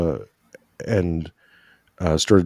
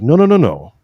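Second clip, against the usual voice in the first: emphatic protest; 5 semitones higher, 4 dB louder than usual. Retold or imitated. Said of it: "I'm like".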